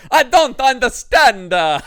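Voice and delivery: loud, high, dragged-out cartoon Italian accent; over-the-top cartoon Italian. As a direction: ham up an Italian accent at full volume. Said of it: "over-the-top cartoon Italian Ian Richardson's like".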